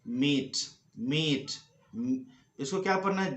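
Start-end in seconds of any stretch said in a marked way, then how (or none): 0.1-1.5 मीट as one syllable, "meet"; correct long ee. Shown as a demonstration. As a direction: model मीट with silent final e.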